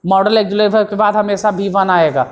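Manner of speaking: normal talking voice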